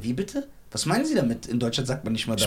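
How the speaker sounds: low, soft, stunned disbelief; understated shock, voice dropped low and small; imitated shock. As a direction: act out a friend quietly taken aback. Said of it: "der Arme so voll geschockt".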